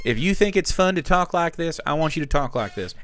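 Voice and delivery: put-on southern voice; southern accent. Shown as a demonstration. Telling first-time players any voice is fine, southern accent. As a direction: talk with a southern twang. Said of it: "could be your southern voice".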